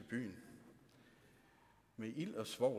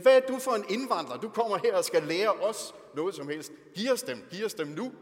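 much higher, much louder, fast furious yelling; angry mob shout; 8 semitones higher, 13 dB louder than usual. Acted. The townsmen turn on Lot the outsider, furious shouting. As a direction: scream at him like a hostile crowd.

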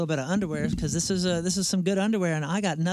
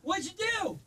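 tense countdown; 8 semitones higher, 4 dB quieter than usual. Real, not acted. Counting down before being shot.

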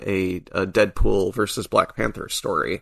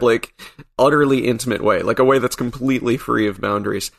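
ordinary delivery

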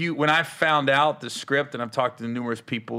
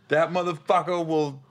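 ordinary talk

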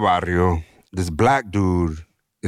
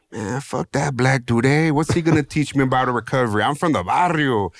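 put-on higher, brash young Chicano homeboy voice; raised, slangy barrio teen sneer; cocky barrio kid; 5 semitones higher than usual. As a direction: scoff it like a swaggering eighteen-year-old from the barrio.

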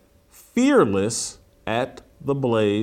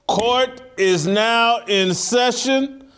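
town crier; 10 semitones higher, slower than usual. Acted. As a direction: call the court to order like a herald. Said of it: "I'll be back as Judge Jason".

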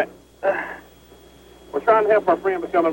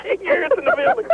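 laughing; 13 semitones higher than usual. Real, not acted. Laughing after bullhorn prank.

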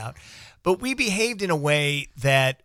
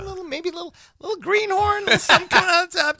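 high squeaky voice for the little buck; tiny little buck. Retold or imitated. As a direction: pitch it way up like something tiny.

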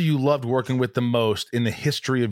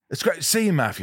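higher-pitched Cockney greeting, "Maffew"; Cockney attempt; 4 semitones higher than usual. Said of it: "Let me hear a little Cockney".

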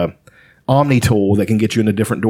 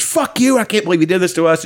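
high, outraged cry, then faster; retold meltdown, voice jumps up; imitating a betrayed character losing it; freaking out; 6 semitones higher than usual. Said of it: "freaks out and says".

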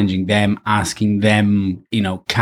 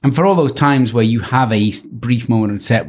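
ordinary talk, nothing marked

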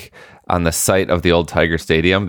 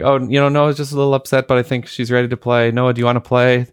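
coaxing; 7 semitones higher than usual. Retold or imitated. Dad answering coach, coaxing daughter.

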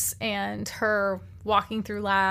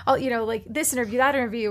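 ordinary delivery

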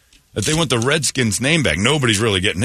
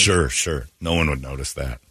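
sarcastic; 11 semitones lower, 4 dB quieter than usual. Real, not acted. Sarcastic dismissal.

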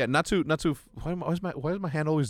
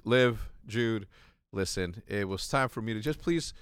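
softer, lower, composed goodbye message; calm farewell; 3 semitones lower, 6 dB quieter, slower than usual. Pretend. Recording a last video for loved ones before crashing, calm. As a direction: speak gently as if leaving last words. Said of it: "I would be calm in my".

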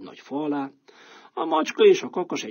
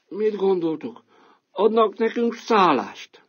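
slightly higher, slow and hopeful; dog asking; 8 semitones higher, 5 dB louder, slower than usual. Acted. Dog asking the band about shelter, hopeful. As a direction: ask the others hopefully. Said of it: "kérdezte a kutya".

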